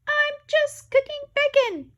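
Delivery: high, loud, slow shout at the beeper. Acted. Inventor shouting at her smoke detector, high and loud. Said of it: "when you shout".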